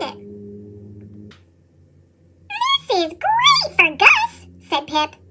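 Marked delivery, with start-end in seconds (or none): 2.5-4.5 much higher, slow giggly mouse voice; proud tiny mouse, giggling; delighted squeaky mouse. Acted. Pip pleased with his find.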